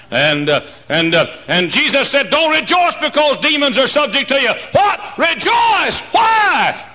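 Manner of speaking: building to a high, loud shout; full-force preaching. Real, not acted. Preacher urging the church to rejoice, high full-voiced shouting. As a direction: drive up to shouted one-word bursts.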